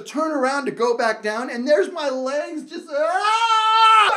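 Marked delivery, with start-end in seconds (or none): 2.9-4.1 high, loud panicked scream. Retold or imitated. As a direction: scream as the chair swings you. Hanging from turning chair.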